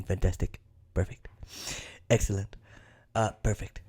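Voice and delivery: low, soft, monotone impression; Trump. Host plays Trump threatening Republicans, hushed impression. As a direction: murmur it low on one level, trailing through the praise. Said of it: "even though Trump threatened them, like".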